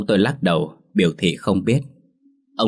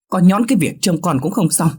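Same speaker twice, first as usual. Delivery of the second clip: put-on much higher, louder sharp scolding old woman; grinding scold; 11 semitones higher, 5 dB louder than usual. Grandmother scolds grandfather for letting baby cry. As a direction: tear into the useless husband. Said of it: "gằn giọng".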